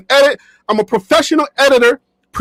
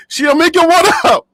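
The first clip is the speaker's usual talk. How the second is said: put-on high, playful Liberian accent; 4 semitones higher than usual. Shouting out a viewer by name, playful Liberian accent. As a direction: greet in a put-on Liberian accent, pitch lifted. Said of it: "y'all miss my Liberian accent".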